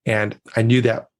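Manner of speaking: ordinary talk, nothing marked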